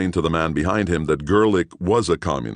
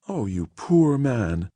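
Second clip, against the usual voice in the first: pitying; 8 semitones higher, slower than usual. Acted. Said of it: "looked at him pityingly".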